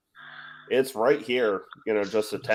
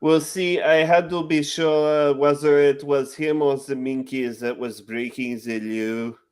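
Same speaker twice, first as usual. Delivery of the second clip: hammed-up French inspector accent, a bit higher; Clouseau French accent; 3 semitones higher than usual. Retrying the Pink Panther quote for friends, in a hammy French accent. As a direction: lean into an overdone French accent. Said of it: "the second time around was, was actually better".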